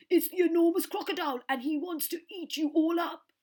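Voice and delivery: very loud, high bellow; bellowing elephant. A narrator's reading. Trunky warning the children, very loud bellow. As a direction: keep trumpeting the warning. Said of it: "he bellowed".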